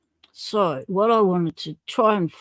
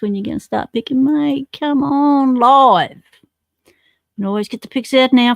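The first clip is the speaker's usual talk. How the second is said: louder and higher, egging a viewer on to go live; teasing call-out; 6 semitones higher, 6 dB louder than usual. Real, not acted. Streamer to viewer Mossy Pig, urging him live, teasing call-out. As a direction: call out to a mate who won't come on camera.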